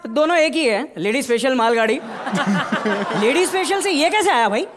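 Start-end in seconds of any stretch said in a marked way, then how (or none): 2.0-3.2 laughing. Real, not acted. Inspector laughs at own joke.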